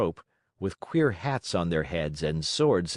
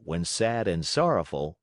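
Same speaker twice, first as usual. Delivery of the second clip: ordinary delivery, nothing marked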